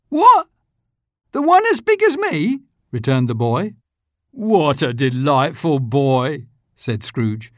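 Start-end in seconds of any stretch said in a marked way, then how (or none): 0.1-2.6 much higher, louder, amazed squeal; the boy can't believe it; astonished boy. A narrator's reading. Boy amazed at the big turkey, high astonished cry. 4.3-6.5 delighted. A narrator's reading. Scrooge delighted.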